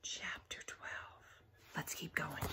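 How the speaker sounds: soft whisper to the class; whispered aside. Real, not acted. Teacher whispering to the class, hushed. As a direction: drop to a hush between chapters. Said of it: "teacher whispers asides".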